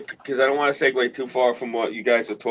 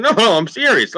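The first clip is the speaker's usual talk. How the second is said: higher, pleading insistence; insisting harder; 10 semitones higher, 4 dB louder than usual. Retold or imitated. Still convincing his friends he means it, higher and pleading. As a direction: beg them to believe you. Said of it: "I'm like".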